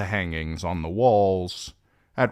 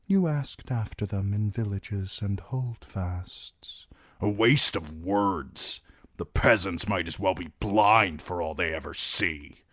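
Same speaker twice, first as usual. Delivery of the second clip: cold Lord Bolton; 2 semitones higher than usual. Acted. Narrator voices Roose Bolton.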